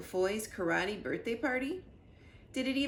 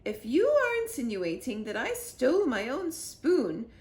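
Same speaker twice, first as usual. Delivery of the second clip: louder, higher offended queen; indignant queen; 4 semitones higher, 7 dB louder than usual. A narrator's reading. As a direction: bristle at the accusation. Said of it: "said the Queen".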